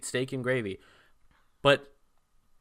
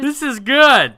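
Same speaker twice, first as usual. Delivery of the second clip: much higher, louder, slow mock-pleased verdict; nerdy guy tasting; 12 semitones higher, 12 dB louder, slower than usual. Retelling the movie, the nerdy guy praises tainted toast, mock-pleased. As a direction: savor it like a clueless dweeb. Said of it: "he's like".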